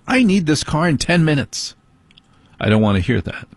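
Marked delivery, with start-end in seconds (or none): 0.1-1.7 higher mock wife voice, insistent; imitating wife. Husband repeats what his wife always tells him. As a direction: play the wife nagging about the car. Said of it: "That's usually what I have to hear".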